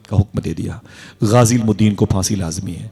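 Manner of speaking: ordinary talk, nothing marked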